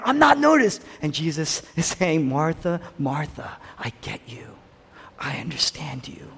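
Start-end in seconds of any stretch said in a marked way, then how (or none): 0.0-0.8 a wounded cry of being ignored; hurt Martha. Retold or imitated. Martha complaining, voiced by preacher. 2.3-6.3 softer, fading to a whisper; gentle hushed reassurance; tender Jesus. Retold or imitated. Jesus comforting Martha tenderly.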